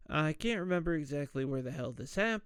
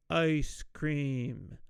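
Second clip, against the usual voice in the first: slow, stretched-out "ice cream"; slower. Retold or imitated. Harold coaching school board man to say it, slowly. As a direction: draw out each syllable of "ice cream". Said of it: "Say it slower".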